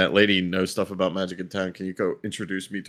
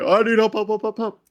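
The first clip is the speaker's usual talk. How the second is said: high frantic pleading; panicked; 5 dB louder than usual. Retold or imitated. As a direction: squeak it in mock panic. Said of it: "I'm like".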